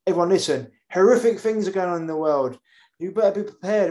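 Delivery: alarmist newscaster. Retold or imitated. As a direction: sound the alarm like a scaremongering anchor. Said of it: "another news channel opens up".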